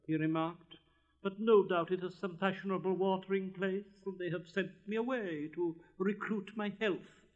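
lofty, self-important pronouncement; haughty rocket. Acted. Rocket whirling through the air, haughtily insisting.

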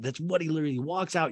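ordinary talk, nothing marked

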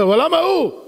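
high, whiny why-him; lightly mock the complaint; a touch of complaining. Worshipper complaining to God, lightly whiny. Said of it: "אתה אומר".

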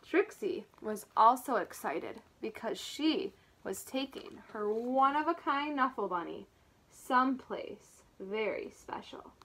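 plain reading voice

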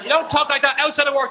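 usual speaking voice

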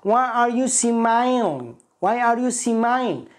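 mock Indian accent; drawn-out Indian English question; imitated Indian accent. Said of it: "شفت مقطع في فيديو".

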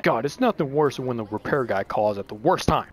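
ordinary delivery

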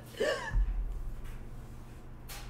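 high unvoiced hiccup gasp; a sudden squeaky hiccup; sharp hiccup gasp. Real, not acted. Hiccup attack.

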